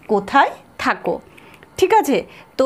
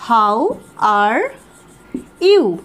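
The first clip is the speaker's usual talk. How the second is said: spaced words, you louder; 3 dB louder, much slower than usual. Shown as a demonstration. Teacher spacing out the greeting for students. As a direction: drill it one word at a time. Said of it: "এটাকে আমরা বলতে পারি".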